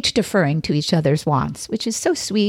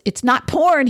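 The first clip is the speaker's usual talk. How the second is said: higher-pitched mock whine; whined; 7 semitones higher than usual. A narrator's reading. Husband defends himself to his angry wife, whining. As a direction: protest in a whiny, pleading way. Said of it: "he whined".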